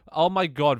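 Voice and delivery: sudden squeaky voice break; voice crack. Real, not acted. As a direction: voice cracking up high. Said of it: "voice crack again".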